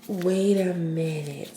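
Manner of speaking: ordinary delivery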